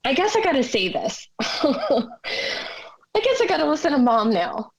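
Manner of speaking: laughing resignation. Retold or imitated. Scared kid laughing at giving in to mom's advice. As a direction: shrug it off with a nervous laugh. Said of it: "I was like".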